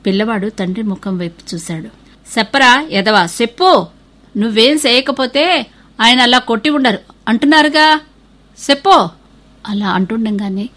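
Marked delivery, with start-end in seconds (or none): 2.4-9.0 higher, louder scolding; father scolding his son to confess. A narrator's reading. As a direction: bark at the boy to speak up.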